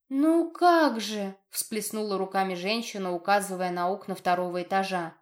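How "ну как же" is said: high, slow exclamation; drawn-out, agitated mother's cry; distraught protest. A narrator's reading. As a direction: protest as if it were obvious. Distraught mother protests to doubting healer.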